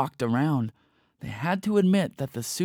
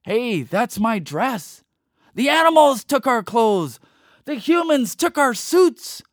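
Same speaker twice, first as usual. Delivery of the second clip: high, loud, slow outcry; indignant humans; 10 semitones higher, 7 dB louder than usual. Acted. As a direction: cry out about the stolen clothes. Humans protest stolen clothes.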